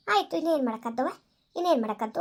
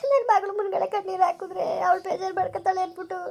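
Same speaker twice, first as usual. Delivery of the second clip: tearful lament; 5 semitones higher than usual. Acted. Mother laments to neighbour after sending daughter away, tearful higher voice. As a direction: hold back sobs while grieving.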